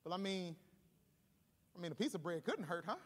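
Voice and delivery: low, soft mock tempting voice; sly little voice of the flesh, lightly played; coaxing flesh. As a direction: murmur a casual temptation. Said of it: "that flesh, that goes".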